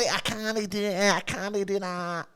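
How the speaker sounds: mock Scottish accent. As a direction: put on a lazy Scottish drawl. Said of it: "That's how they speak".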